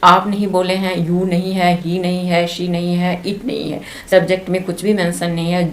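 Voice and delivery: normal talking voice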